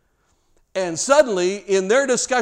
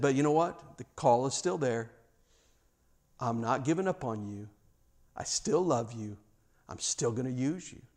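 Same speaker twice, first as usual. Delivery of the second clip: tender reassurance; 5 semitones lower, 9 dB quieter than usual. Acted. Jesus reassures failed disciples.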